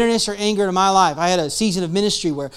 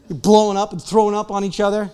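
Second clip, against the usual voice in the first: ordinary talk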